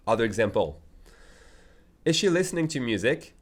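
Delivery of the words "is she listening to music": ordinary talk, nothing marked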